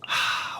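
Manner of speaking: soft voiceless sigh; a quiet airy exhale; breathy sigh. Acted. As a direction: breathe out quietly, almost content. Breathy sigh before remark.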